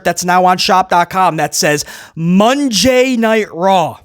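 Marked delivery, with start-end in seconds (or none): 2.2-4.0 slow, drawn-out mock announcement; milk each word like a joke; deadpan slow reading of a slogan; mock-solemn read. Reading the shirt in mock awe.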